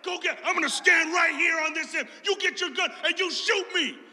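a real hothead's challenge, shouted; hot-tempered dare. Retold or imitated. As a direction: yell it like a hothead daring him to shoot. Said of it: "My hot-tempered friend said".

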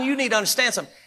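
ordinary delivery

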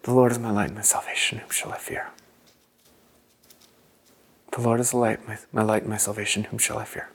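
quiet breath prayer muttered in a tense meeting; under your breath. Shown as a demonstration. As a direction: pray it to yourself so no one in the room hears. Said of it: "happens under your breath".

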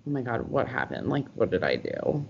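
low, soft retold worry; quiet worry. Girlfriend answering a call from the bathroom, quiet worry. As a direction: ask it softly and low, as if in trouble.

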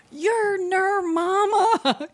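high, very drawn-out imitation of a gushing fan; mock starstruck greeting. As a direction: stretch every word high, imitating an awed greeting. Quotes husband greeting rock star's mom.

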